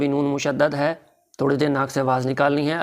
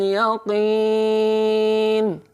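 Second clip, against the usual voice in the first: melodic chanted recitation; तजवीद से तिलावत; 7 semitones higher, much slower than usual. Shown as a demonstration. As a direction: recite it sung for the class to repeat. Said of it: "आप भी कोशिश कीजिए". (सुरीली तिलावत)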